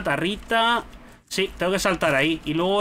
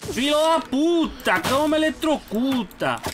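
high yelp of pain; pained shout; 9 semitones higher than usual. Real, not acted. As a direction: yell as the shock hits. Getting electrocuted in game.

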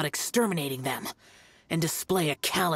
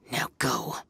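hushed dismissal; 3 semitones lower than usual. Acted. Xiao dismisses the false Adeptus.

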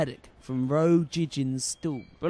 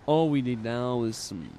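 normal talking voice

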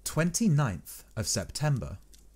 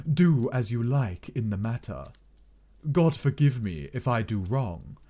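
ordinary delivery, nothing marked

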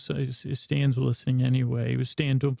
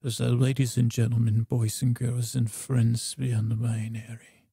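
show announcer; 2 semitones lower, slower than usual. Acted.

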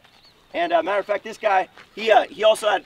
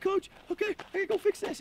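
put-on higher, softer voice of a hurt kid; lightly acted tearful plea to get away; imitated crying kid; 10 semitones higher, 6 dB quieter than usual. Injured kid trying to walk away, imitated tearful plea. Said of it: "T J's crying".

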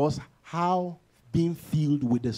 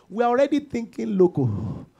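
ordinary talk, nothing marked

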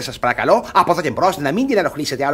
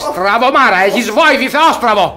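louder, indignant telling-off; angry scolding; 5 semitones higher, 5 dB louder than usual. Acted. Guardian berating the young man who bumped him, loud and angry. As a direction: scold the clumsy fool loudly.

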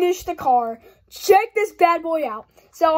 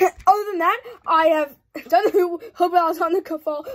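odd put-on voice; 4 semitones higher, 5 dB louder than usual. Real, not acted. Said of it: "I don't know why I was talking like that".

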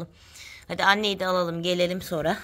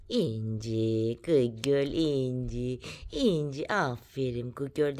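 soft, low, drawn-out cooing; cooing praise; 5 semitones lower, 5 dB quieter, much slower than usual. Real, not acted. Praising İnci the good girl, slow low cooing. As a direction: stretch the name out softly and lower.

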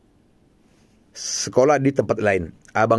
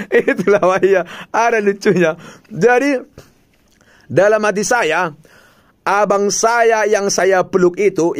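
laughing; 7 semitones higher, 3 dB louder than usual. Real, not acted. Laughing retelling the mix-up.

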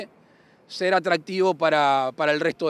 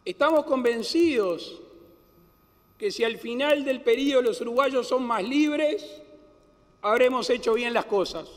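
raised pitch, measured oratory; formal speech; 8 semitones higher than usual. Acted. Formal inaugural address.

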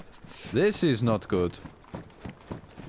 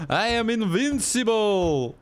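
megalomaniac rant; 11 semitones higher, slower than usual. Pretend. Hammed-up boast on ladder.

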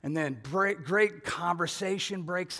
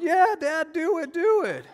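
put-on much higher, kids egging on their dad; kids cheering; 13 semitones higher, 7 dB louder than usual. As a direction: squeal it like eager children.

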